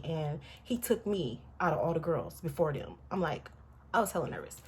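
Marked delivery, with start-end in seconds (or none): none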